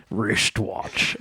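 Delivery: low Scottish "rishtwatch"; Sean Connery. Acted. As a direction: return to the mock Scottish star voice. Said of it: "a Sean Connery accent".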